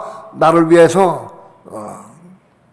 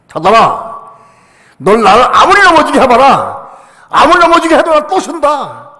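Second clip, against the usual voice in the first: defiant shout, much louder and higher; shouting at Satan; 10 semitones higher, 7 dB louder than usual. Retold or imitated. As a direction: yell it at Satan, unbowed. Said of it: "외쳤느냐 하면".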